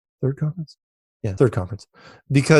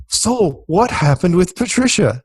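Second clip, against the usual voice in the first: curious audience question; 7 semitones higher, slower than usual. Retold or imitated. Audience member asking question.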